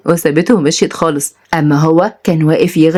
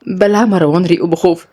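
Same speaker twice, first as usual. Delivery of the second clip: usual speaking voice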